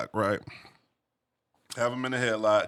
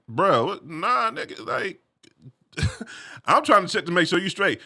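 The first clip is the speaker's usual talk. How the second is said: retold disbelief; 8 semitones higher than usual. Friend asks if he wants fight.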